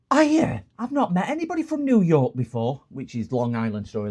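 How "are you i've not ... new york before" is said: very high, loud gushing mimicry; imitated excited assistant. Shop girl meets a supposed New Yorker, mock excited.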